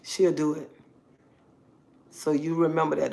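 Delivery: ordinary talk, nothing marked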